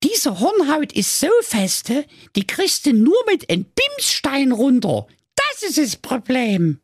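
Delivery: very high, squeaky princess in Saxon. A narrator's reading. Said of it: "sagte die Prinzessin Milram".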